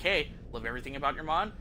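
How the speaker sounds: ordinary talk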